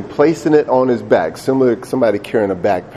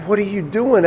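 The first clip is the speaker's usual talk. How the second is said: acted tearful question, pitched up; Isaac crying; 8 semitones higher than usual. Isaac to his father as he is tied, tearful plea. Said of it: "he starts crying".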